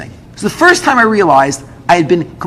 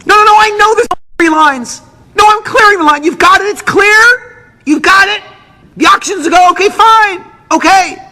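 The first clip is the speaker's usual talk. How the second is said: much higher, louder frantic phone shouting; screaming into phone; 6 dB louder than usual. Retold or imitated. Doctor screaming into the phone to clear the line, frantic. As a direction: yell urgently down a phone line. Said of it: "screaming into the phone".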